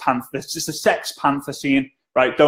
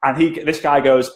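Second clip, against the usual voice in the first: normal talking voice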